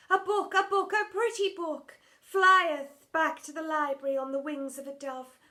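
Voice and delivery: high, loud, overwrought stage voice; hammed-up old-English drama queen; theatrical Shakespearean lament. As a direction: wail it like a tragic heroine on stage. Drama teacher's ode to a book.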